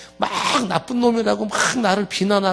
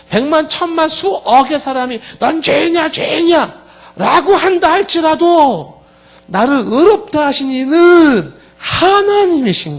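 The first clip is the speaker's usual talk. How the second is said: impassioned preaching; 6 semitones higher, 4 dB louder than usual. Real, not acted. Climax of sermon point.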